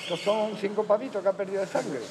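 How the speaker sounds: normal talking voice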